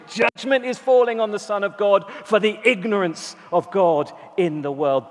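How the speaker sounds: usual speaking voice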